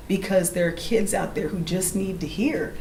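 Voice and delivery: usual speaking voice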